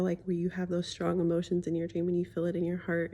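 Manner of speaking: usual speaking voice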